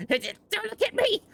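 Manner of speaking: high, whimpering goblin; scared goblin voice. Acted. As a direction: squeak it out like a cowering goblin. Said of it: "Urok's just, uh, kinda ch".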